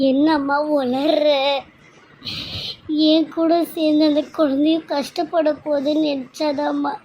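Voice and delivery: tearful, broken protest; crying. Acted. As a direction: choke the words out while weeping. Daughter breaks down.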